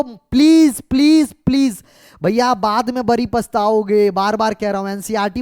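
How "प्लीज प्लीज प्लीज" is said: emphatic pleading. Real, not acted. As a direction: beg the students, punching each word. Teacher pleading with students to read.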